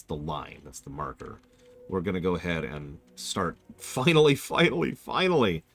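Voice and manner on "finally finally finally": excited. Real, not acted. Excited repeat of finally.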